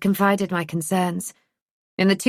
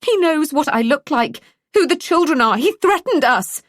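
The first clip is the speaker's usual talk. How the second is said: higher and louder, rising panic; voice rising in fear; 9 semitones higher, 4 dB louder than usual. A narrator's reading. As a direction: pitch climbing with fear. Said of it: "Her mum's voice rose in pitch".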